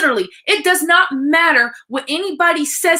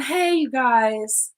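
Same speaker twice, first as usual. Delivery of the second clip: fake sweet greeting; 4 dB quieter than usual. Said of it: "because that's not me and that's not my truth".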